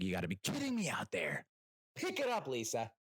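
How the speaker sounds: a hint of a yelling coach; football coach. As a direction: bark at the team from the sideline. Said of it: "old-timey football coach".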